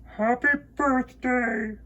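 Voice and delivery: echoing moon. Acted. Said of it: "said the moon".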